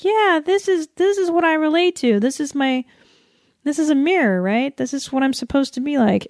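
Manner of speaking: high, girly mock voice of a little girl; sing-song little girl idolizing the doll. Little girl voiced, mocking dolls.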